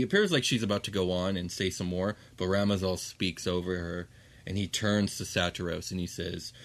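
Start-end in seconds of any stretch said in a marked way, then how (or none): none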